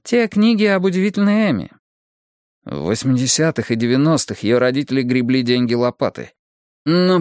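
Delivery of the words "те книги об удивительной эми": higher-pitched female agreement; Bonnie. A narrator's reading. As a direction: chime in with a lifted woman's voice. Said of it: "кивнула Бонни". (высокий женский голос, соглашаясь)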